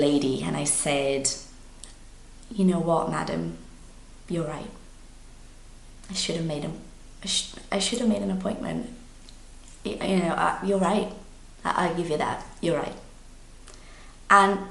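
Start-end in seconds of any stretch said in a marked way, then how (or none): 2.5-13.0 retelling a soft, conciliatory admission; calm concession. Retold or imitated. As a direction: give in gently and quietly, with pauses. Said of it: "I just took a deep breath".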